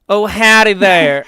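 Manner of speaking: slow, loud mock Southern Belle drawl; hammed-up Southern Belle greeting; Southern Belle accent. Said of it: "Southern Belle accent".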